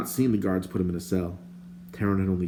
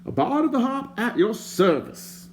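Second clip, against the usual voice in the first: higher, prim and indignant stranger; lightly voiced fussy stranger; 13 semitones higher than usual. A narrator's reading. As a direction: play the fussy bard, put out. The bard's voice.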